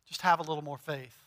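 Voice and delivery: a hint of hollow reassurance; mock soothing platitude. Friend telling a troubled soul to trust, soft platitude. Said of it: "that person who's like".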